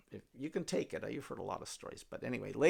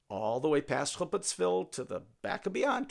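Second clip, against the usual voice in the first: ordinary delivery, nothing marked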